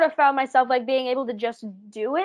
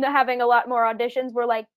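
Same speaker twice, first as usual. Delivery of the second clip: usual speaking voice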